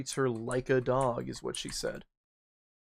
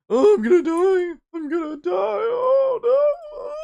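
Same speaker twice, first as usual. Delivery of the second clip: much higher and louder, hammed-up terror; mock panicked shriek; 9 dB louder, slower than usual. Caught by her other guy, a hammed-up panicked shriek. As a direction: squeal like you're about to die.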